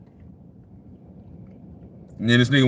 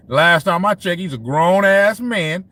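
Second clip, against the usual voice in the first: ordinary talk, nothing marked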